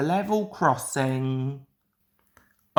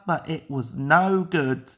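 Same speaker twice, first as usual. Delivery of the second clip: usual speaking voice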